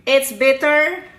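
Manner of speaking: normal talking voice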